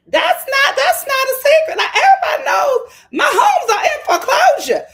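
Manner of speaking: high, loud, laughing denial; loud laughing protest. Retold or imitated. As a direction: laugh it off loudly. Angela loudly brushing off foreclosure.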